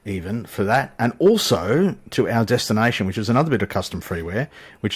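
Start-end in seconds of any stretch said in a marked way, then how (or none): none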